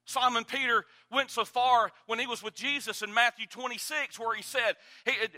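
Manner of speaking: ordinary talk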